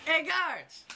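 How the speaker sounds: calling out. Acted. Calling out to passing guards.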